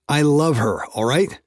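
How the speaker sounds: loud, high outburst; shouting. Acted. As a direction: blurt it out as a shout. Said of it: "I shouted".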